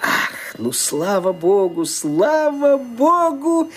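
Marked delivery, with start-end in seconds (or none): 1.8-3.7 slow, drawn-out relief, pitched up; slow relieved thanks to God; relieved sigh. Acted. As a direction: let the relief pour out slowly.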